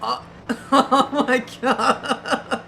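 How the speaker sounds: helpless laughter over the bumps; breaking up, slow, then silent laughter; laughing. Real, not acted. Driver laughing helplessly at the bumpy old track.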